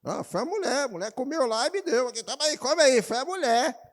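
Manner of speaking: high, pleading blame-shifting voice; mock whining husband. Pregador imita o homem culpando a mulher. (voz de marido se desculpando, aguda)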